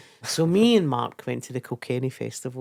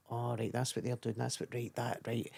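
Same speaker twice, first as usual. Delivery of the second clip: murmured under the breath, much softer; quiet dawning realisation; 4 semitones lower, 12 dB quieter than usual. Retold or imitated. Comic watching other acts, realising quietly. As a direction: mutter the penny dropping to yourself.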